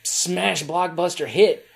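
usual speaking voice

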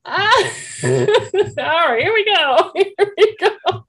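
high, loud laughing exclamation. Real, not acted. Counselor laughing at the parents' sleep revelation. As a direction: burst out laughing and rally.

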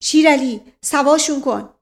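shouted command to step in; urgent shout. A narrator's reading. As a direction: call out a loud, sharp order. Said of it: "دایجان تا او را دید فریاد زد".

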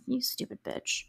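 muttered insult. Retold or imitated. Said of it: "you're like".

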